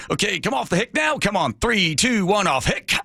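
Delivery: high, urgent pilot count; pilot coaching voice. Retold or imitated.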